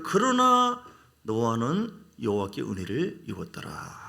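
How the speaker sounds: slow, solemn recitation of the verse; slow solemn Bible reading; scripture reading. Acted. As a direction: read it out as holy writ.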